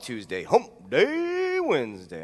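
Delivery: drawn-out cartoonish "Hump Day Wednesday."; silly voice inflection. Shown as a demonstration. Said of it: "I like that voice inflection".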